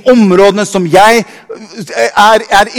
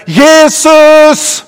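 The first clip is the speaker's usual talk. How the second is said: high ringing call of the name; shouted name; 7 semitones higher than usual. Retold or imitated. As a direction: cry out Jesus like the blind beggar. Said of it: "når han begynte å rope".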